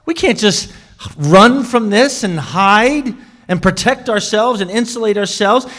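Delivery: pressing her husband to stand firm; wife urging. Retold or imitated. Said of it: "And then she said".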